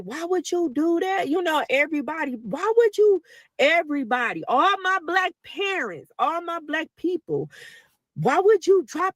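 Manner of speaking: high, disbelieving mock scolding; incredulous dad. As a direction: imitate dad and family asking why, pitched high. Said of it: "my dad was like".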